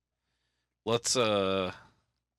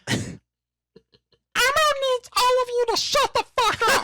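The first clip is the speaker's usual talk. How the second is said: slow, very high puppet squeal; drawn-out children's puppet falsetto; Elmo; 5 dB louder, slower than usual. Acted. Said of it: "Now we're gonna do Elmo".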